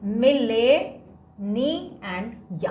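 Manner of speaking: "मिले, नी and यम" slowly, with gaps between parts; slow spelling-aid chunks "मिले, नी and यम"; syllable breakdown. Shown as a demonstration. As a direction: lift "मिले", pause, then "नी and यम". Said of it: "तो यहाँ पे हम क्या लिखेंगे".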